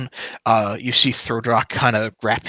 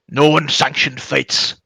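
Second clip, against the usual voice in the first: raised, commanding dwarf voice; dwarf guard; 4 semitones higher, 5 dB louder than usual. Acted. Dwarf with crossbow breaks up a fight, commanding voice. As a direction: lay down the law as the dwarf. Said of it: "the dwarf to the south has his crossbow".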